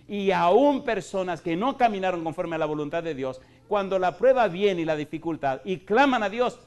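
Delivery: loud, rising preacher's call; fervent pulpit preaching; full-force preaching. Acted. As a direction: drive the point home to the congregation.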